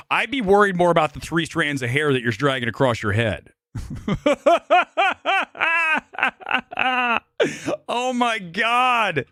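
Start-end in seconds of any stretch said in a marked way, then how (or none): 3.7-7.8 a high squealing burst of laughter; big high laugh. Real, not acted. Streamer cracking up at his own roast, high squealing laugh. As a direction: crack up at your own joke, high and long.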